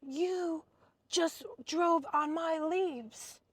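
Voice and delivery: high, slow, put-on neighbor voice; mimicking the neighbor lady. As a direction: neighbor lady scolding about her leaves, high and slow. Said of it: "She goes".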